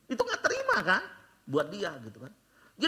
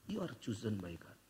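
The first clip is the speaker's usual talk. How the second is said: soft voice of God; 13 semitones lower, 10 dB quieter than usual. Acted. God speaking softly.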